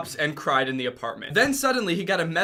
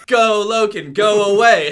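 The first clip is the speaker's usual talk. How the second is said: loud slow; 4 semitones higher, 10 dB louder, slower than usual. Acted. Acted dismissal.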